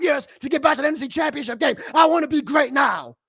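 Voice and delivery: ranting shout. Real, not acted. Fan yelling at his team to want excellence now. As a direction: shout it out, fed up and demanding. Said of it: "My rants are never predetermined".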